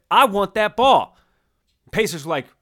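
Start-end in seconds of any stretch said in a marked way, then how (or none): 0.1-1.2 loud, high demand; hammed-up sports-pundit outburst; Stephen A Smith. Star demanding the game ball, hammed-up sports-pundit impression. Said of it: "to channel our Stephen A Smith".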